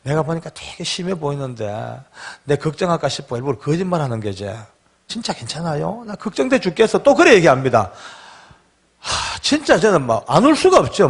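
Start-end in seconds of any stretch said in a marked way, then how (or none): 0.0-7.0 soft, hushed words in a father's ear. Retold or imitated. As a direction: speak softly as if right at his ear. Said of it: "제 귀에 대고 이렇게 얘기해요". (귀에 대고 조용히 속삭이듯)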